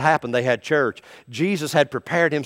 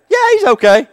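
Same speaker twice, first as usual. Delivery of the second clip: much higher, brushing it off; high breezy nurse; 14 semitones higher, 8 dB louder than usual. Acted. Nurse reassuring the patient, high and breezy. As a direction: chirp it off lightly.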